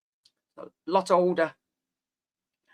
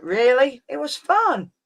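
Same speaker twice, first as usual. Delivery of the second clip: incredulous retort; 7 semitones higher, slower than usual. Retold or imitated. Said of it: "I was like".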